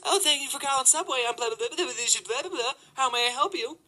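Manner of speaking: high, silly mock receptionist; stupid phone-greeting voice. Store employee answering the Subway phone, mock stupid voice. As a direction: rattle off a dopey, high-pitched greeting. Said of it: "with their stupid voice".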